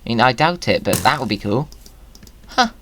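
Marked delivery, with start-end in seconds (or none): none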